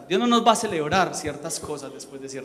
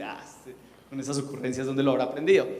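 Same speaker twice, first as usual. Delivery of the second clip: doting parent; 7 semitones lower, 3 dB quieter than usual. Retold or imitated.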